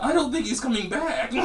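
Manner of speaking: high-pitched imitation of an adolescent voice; young dude's changing voice. Comparing himself to the Simpsons young dude, high changing voice. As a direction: do a Simpsons teen whose voice is still changing. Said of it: "the young dude voice, who's like, you know, still changing".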